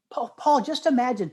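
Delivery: calling out a name, high and eager; excited call. Real, not acted. Excited call to friend mid-story.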